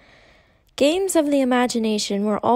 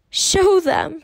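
bossy demand, higher and louder; imperious child's command; 7 semitones higher, 4 dB louder than usual. A narrator's reading. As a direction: order it like a little queen. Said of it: "she commanded".

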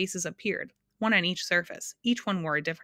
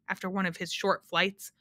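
plain reading voice

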